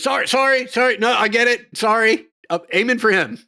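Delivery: high, loud flustered apology; apologetic veteran. Acted. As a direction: blurt out sorry like a rattled soldier. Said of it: "the vet goes".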